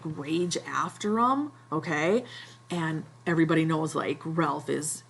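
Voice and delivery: usual speaking voice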